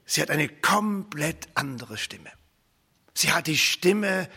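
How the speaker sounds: ordinary talk, nothing marked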